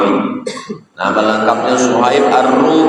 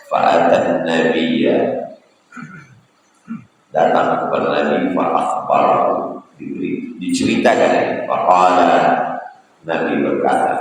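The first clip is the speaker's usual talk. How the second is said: usual speaking voice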